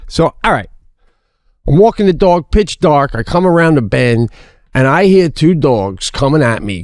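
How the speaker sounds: usual speaking voice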